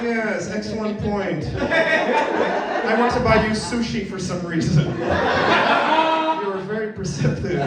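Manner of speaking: high, slow, laughing mock gratitude; hammed-up delighted boyfriend; flattered boyfriend. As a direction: gush like a flattered puffed-up man. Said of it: "I was like".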